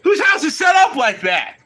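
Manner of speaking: high, loud disbelief; incredulous. Real, not acted. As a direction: demand it in disbelief. Incredulous question.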